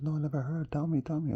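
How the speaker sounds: high, pleading put-on voice; squeaky, keen impression; mock curious woman. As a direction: imitate her eagerly begging to be told. Voices the woman begging to hear.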